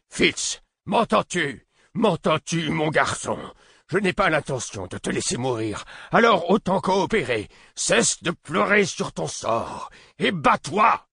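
high, urgent shout into his ear. A narrator's reading. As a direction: yell him back to life. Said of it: "Il cria dans mon oreille".

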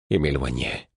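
ordinary delivery, nothing marked

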